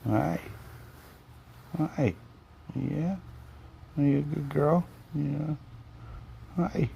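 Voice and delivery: talking to a dog. Real, not acted. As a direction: coo at the puppy. Cooing to the dog again.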